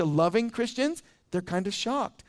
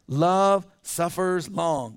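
love suffers long, drawn out slowly; slow emphatic quote; much slower than usual. Shown as a demonstration. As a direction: let each word sink in, slow. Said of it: "We could just stop right there".

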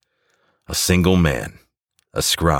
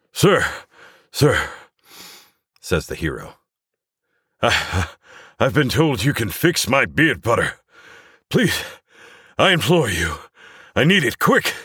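much higher, desperate pleading hero; bold heroic voice; 8 semitones higher than usual. Acted. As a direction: beg like a hero in crisis. Hero begs the inventor.

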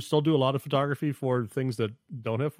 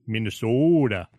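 put-on Minnesota accent; 3 semitones lower, 4 dB louder than usual. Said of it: "do that northern accent really well".

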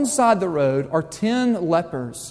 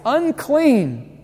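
high, loud call of Unclean; yelled warning; 9 semitones higher, 4 dB louder than usual. Retold or imitated. Leper warning passers-by to keep away, loud high call. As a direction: call out Unclean to warn people off. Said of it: "had to yell out all of your life".

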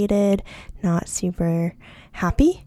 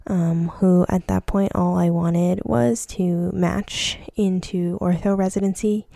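ordinary talk, nothing marked

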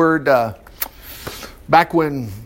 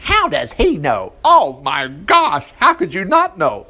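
mock disbelief; slower than usual. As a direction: drag it out as if shocked. Preacher mocks listener's disbelief.

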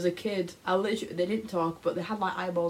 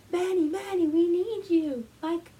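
high, urgent plea for help; pleading cartoon customer; 8 semitones higher than usual. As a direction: call out for Manny, a bit frantic.

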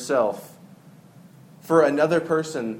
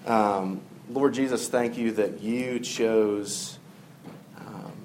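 prayer; 5 semitones lower, 4 dB quieter than usual. Acted. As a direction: pray quietly to the Lord. Closing prayer.

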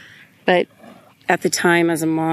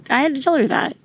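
voice breaking; 9 semitones higher, much faster than usual. Real, not acted. Mom recalling comforting her sick daughter, voice breaking high. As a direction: voice jumps much higher, near breaking.

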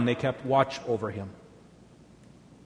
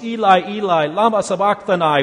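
raised, loud cry in a reader's voice; projected anguished plea, narrated; 5 semitones higher, 7 dB louder than usual. A narrator's reading. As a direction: cry it out loudly, still measured. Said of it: "cried out with a loud voice".

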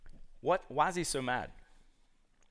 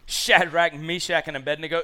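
a touch of furious king; mad king; 6 dB louder than usual. Retold or imitated. King confronting the three men, mock angry. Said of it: "remember, he's so mad".

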